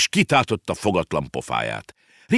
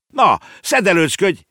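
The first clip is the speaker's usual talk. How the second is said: put-on high, loud boyish order; impatient shout; 12 semitones higher, 7 dB louder than usual. Boy hurrying his uncle up, shouting. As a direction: shout to hurry him along. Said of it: "kiáltott rá Konrád".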